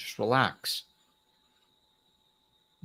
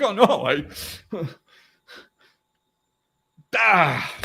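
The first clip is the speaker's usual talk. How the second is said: mock dismay; 7 semitones higher, 5 dB louder than usual. Mocking the other person's reaction.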